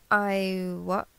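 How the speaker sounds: normal talking voice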